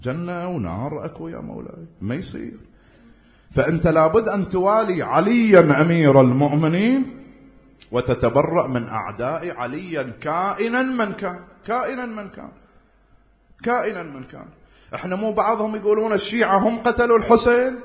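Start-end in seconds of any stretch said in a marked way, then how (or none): 3.5-14.4 loud, insistent sermon delivery; preaching at full force. Acted. Preacher urging listeners, in a loud insistent preach. Preacher exhorting loyalty to Ali. 14.9-17.8 loud, insistent sermon delivery; preaching at full force. Acted. Preacher urging listeners, in a loud insistent preach.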